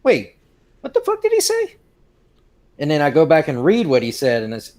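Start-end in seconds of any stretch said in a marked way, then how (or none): 0.0-1.8 baffled disbelief. Retold or imitated. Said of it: "I would think back and wait and go".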